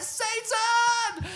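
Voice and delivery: high shrieked yell, half laughing; mock shriek. Retelling a drunk kiss to a friend, shrieking in mock alarm. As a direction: screech it like a startled joke.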